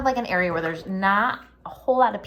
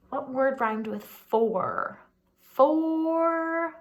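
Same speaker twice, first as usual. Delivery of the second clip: ordinary talk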